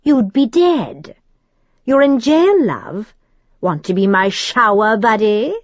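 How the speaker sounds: put-on precise demon accent. A narrator's reading. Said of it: "his accent clear and precise".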